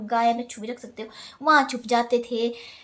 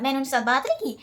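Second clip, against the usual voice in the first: normal talking voice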